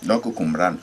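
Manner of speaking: normal talking voice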